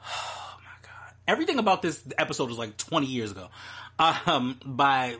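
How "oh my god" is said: breathless whispered aside. Real, not acted. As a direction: whisper it in disbelief. Aside about old dates.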